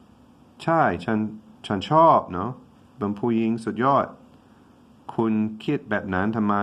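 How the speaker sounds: rapid Thai reading of the dialogue; fast Thai translation. Shown as a demonstration. Teacher translating the dialogue for students, quickly. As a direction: rush through the Thai lines. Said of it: "All right, so".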